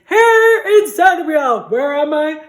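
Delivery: loud, slow, high proclamation; loud drawn-out declaration; excited announcement. Real, not acted. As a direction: proudly declare it.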